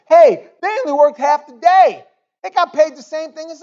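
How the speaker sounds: high, loud mock grumbling worker; shrill-free high mocking gripe; whiny complaint. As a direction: complain like a cheated day laborer. Workers complaining about pay.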